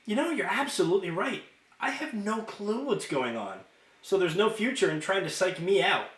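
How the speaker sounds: put-on a carefree girl brushing off a threat; chirpy girl. A narrator's reading. Juliet voiced by reader.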